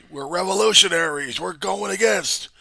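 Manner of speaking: high, loud, indignant mock proclamation; mock revolutionaries. Mimicking rioters' claim to be revolutionaries, loud and high. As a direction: shout it out as a self-righteous protester. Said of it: "it would claim that".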